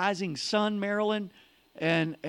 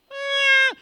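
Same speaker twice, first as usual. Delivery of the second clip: high, loud imitated cry; loud high mock yelp; mock dive cry; 9 dB louder than usual. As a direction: yelp like a plane in a plunge.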